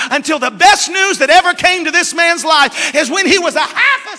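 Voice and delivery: loud, rising revival preaching; preaching at full force. Acted. Preacher to his church, urging them, loud full-force preaching. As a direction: press the point home to the congregation.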